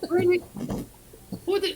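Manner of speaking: high incredulous yelp; shocked. Real, not acted. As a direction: cry out in disbelief. Shocked outburst.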